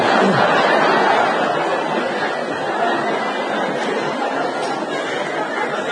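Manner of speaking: long unvoiced laugh; laughing at his own joke; breathy laugh. Real, not acted. Preacher to congregation after punchline, long laugh.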